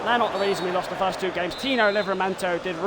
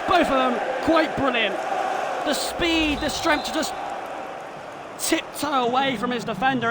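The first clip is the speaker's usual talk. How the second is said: higher, upbeat commentary read; live play-by-play; 6 semitones higher than usual. Acted. Gamer commentating the replay, upbeat play-by-play. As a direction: keep the commentator energy going.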